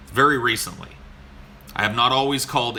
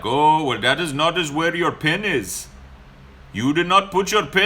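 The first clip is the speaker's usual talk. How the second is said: higher, louder scolding in a mock Indian accent; 6 semitones higher, 4 dB louder than usual. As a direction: blame the rider in a put-on Indian accent. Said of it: "And he's like".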